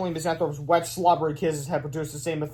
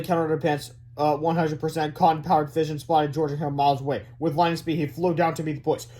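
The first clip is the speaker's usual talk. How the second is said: plain reading voice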